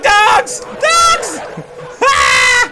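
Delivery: high, loud panicked yell; panicked scream. Real, not acted. Player fleeing the dogs, screaming high and loud.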